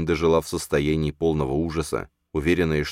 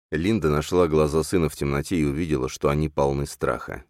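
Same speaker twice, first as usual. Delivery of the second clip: usual speaking voice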